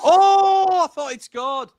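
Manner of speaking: high, loud "oh" dropping off in level; excited near-miss cry. Real, not acted. Co-host reacting to a near miss, high excited cry. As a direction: yelp as if it went in.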